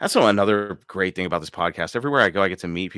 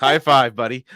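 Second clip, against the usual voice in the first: loud, high, playful cheer with a laugh; giddy mock congratulations to the groom; mock high five; 6 semitones higher, 8 dB louder than usual. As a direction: cheer him on like a buddy. Imagined cheer to Keaton.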